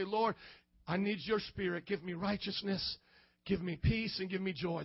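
low, softened plea to God; earnest prayer. Acted. Repentant man asks the Lord for righteousness, peace and joy, hushed earnest prayer.

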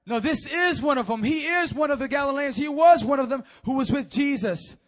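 high, loud insistent charge; loud accusation. Acted. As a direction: insist loudly he's one of them.

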